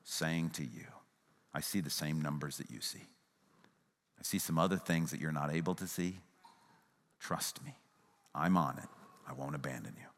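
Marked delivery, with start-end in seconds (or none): 1.5-8.9 softer, gentle reassurance; tender voice of a God who loves you; loving God. Pretend. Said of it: "Hear a God who loves you". 9.2-10.1 much softer, hushed and tender; tender promise. Pretend. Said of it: "Hear a God who loves you".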